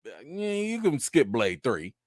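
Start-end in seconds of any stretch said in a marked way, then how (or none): none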